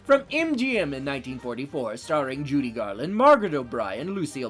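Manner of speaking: loud showbiz announcer read; announcer intro. Acted. Host introducing guest and film, announcer read. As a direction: sell every name like a radio host.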